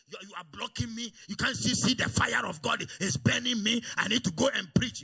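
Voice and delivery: loud mock-fervent cry, rising; mock zealous convert. Preacher mocking a stubborn zealot, loud fervent cry. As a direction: ham up the fired-up young preacher. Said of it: "They will say".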